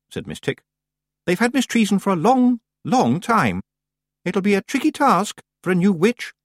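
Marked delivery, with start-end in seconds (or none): 1.3-6.3 high, bright, insistent witch's voice, much higher; a fussy schoolmistress pressing her point, pitched well up; fussy teacher witch. Acted. Miss Tick warns Granny about the ice, high insistent witch.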